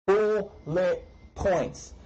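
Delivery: BULLET POINTS drawn out slowly and high, broken into syllables; emphatic syllable breaks. Real, not acted. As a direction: hammer out "Bullet points" piece by piece. Spelling out advice emphatically.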